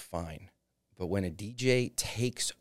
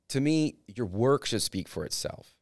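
ordinary delivery

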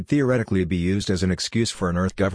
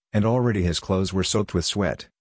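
usual speaking voice

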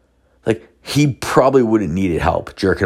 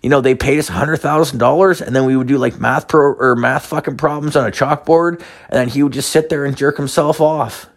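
high-pitched little-person voice; imitated little people; 6 semitones higher than usual. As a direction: pitch the voice up for a tiny witness testifying. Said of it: "these Argentinian midgets are like".

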